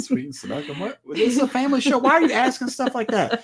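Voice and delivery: high-pitched, laughing protest; mock scandalised. Real, not acted. Husband scolding co-host for racy question, laughing mock outrage. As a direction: object in a squeal through laughter.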